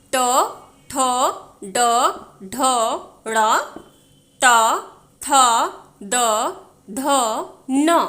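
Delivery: each letter said alone with a pause; reciting again. Shown as a demonstration. Teacher rereads the ट row for learners. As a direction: repeat the row one letter at a time. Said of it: "फिर से मैं पढ़ती हूँ इस line को".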